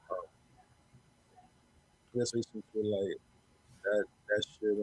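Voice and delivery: muffled, dull audio. Real, not acted. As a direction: voice muffled as if through cloth. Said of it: "like, muffled".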